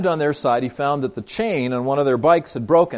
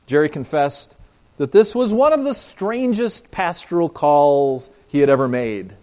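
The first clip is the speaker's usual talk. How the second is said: usual speaking voice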